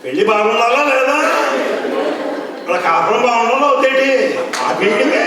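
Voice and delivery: high, loud, rapid Godavari village slang; Godavari dialect. Retold or imitated. Godavari devotee shouting in local slang at the speaker, high and fast. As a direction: shout it like a Godavari farmer protesting. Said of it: "Godavari has got its own slang".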